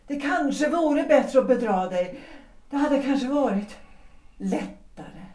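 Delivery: ordinary talk, nothing marked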